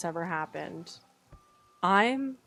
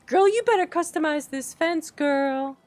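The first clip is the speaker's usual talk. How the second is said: louder, higher sassy order; loud mock diva demand; sassy command; 7 semitones higher, 6 dB louder than usual. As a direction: tell her off with attitude.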